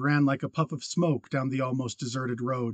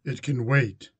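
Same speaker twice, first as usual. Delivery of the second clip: said shortly; slower than usual. A narrator's reading. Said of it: "he said shortly".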